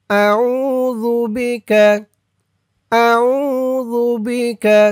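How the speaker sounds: আউযু বিকা drawn out, falling at the end; slow recitation. Shown as a demonstration. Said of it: "যেমন উচ্চারণ করব". (ধীরে আউযু বিকা)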